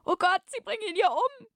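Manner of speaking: very high, hammed-up damsel in fear; fear and worry. A narrator's reading. Young woman seeing the captain beaten, melodramatic fearful cry. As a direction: cry out in a put-on high, frightened voice. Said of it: "In ihrer Stimme lag Furcht".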